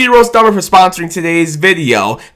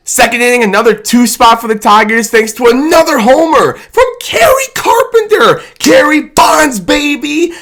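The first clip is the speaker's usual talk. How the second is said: excited shout; 8 semitones higher than usual. Real, not acted. Fan vlogger hyping Kerry Carpenter's homer, high excited shout. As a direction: yell it like the homer just left the park.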